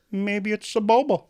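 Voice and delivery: George Lucas. Retold or imitated. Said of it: "he's like".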